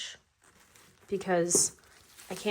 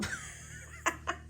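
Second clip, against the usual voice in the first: breathy surprised laugh; 12 semitones higher, 7 dB quieter than usual. Real, not acted. Laughing at the animal.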